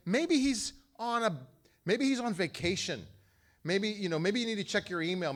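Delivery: high, loud sneering taunt; mock them with a high sneer. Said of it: "he's making fun of them".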